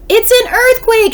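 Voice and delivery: high, loud cry of alarm; panicked shout. A narrator's reading. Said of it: "shouted Missus Watson".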